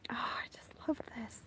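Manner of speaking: fast breathless whisper of delight; barely voiced, rushed and enchanted; whispering in wonder at the lightning; awed whisper. Real, not acted. Awed whisper watching storm.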